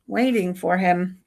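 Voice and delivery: normal talking voice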